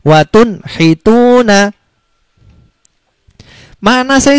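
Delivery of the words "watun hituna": slow, higher watun hituna with a crisp n; clear izhar. Shown as a demonstration. Teacher reciting the example for students, slow and clear. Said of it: "Seperti ini ya".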